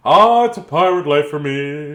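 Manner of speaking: loud hearty pirate growl. Acted. Reading a picture book aloud, loud hearty pirate. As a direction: belt it like a jolly pirate.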